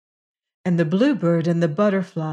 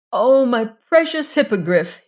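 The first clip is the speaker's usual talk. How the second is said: tender cry; 5 semitones higher, 3 dB louder than usual. Acted. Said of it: "he cried".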